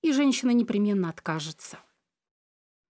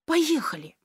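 put-on higher pleading little boy; child begging; 6 semitones higher, slower than usual. A narrator's reading. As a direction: beg mum to go. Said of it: "умоляюще протянул Кирилл".

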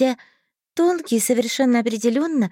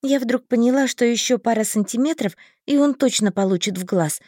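ordinary delivery, nothing marked

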